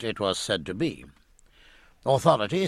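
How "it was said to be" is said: plain reading voice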